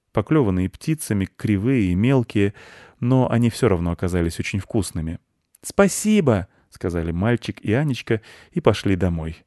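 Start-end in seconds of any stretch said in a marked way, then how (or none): 5.6-6.5 put-on high-pitched child's thank-you; children's thanks. Boy and little girl thanking the chairman, childlike. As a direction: chime it like polite kids.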